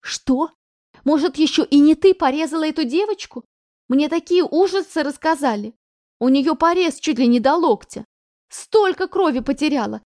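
loud, high, breathless torrent; panicked accusation. Acted. Mother accuses daughter of crippling a girl, loud panicked torrent. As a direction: pour it out in alarmed outrage.

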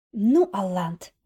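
slow, loud, menacing warning; threatening. Acted. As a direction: promise revenge through teeth. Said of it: "с угрозой пообещала Надежда".

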